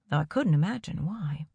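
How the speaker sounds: usual speaking voice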